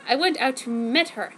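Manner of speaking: ordinary talk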